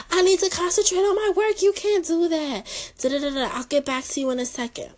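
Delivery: high, brushed-off teacher voice, words hurried at the end; mock a busy teacher waving students away; distracted teacher. Mimicking a male teacher dodging the pregnancy question, high and hurried.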